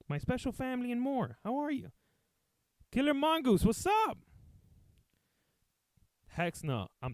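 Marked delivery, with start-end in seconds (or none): 0.1-4.2 high, loud, bubbly greeting voice; mock cheery greeter. Pretending to greet the old man as fans, high and bubbly. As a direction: chirp the hello like a sugary host.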